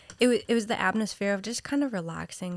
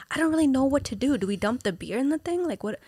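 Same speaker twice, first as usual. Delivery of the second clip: higher, louder and fast, at a loss; flustered panic; 6 semitones higher, 3 dB louder than usual. Retold or imitated. At the bar, unsure what to do, flustered. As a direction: rattle it off in a mild panic.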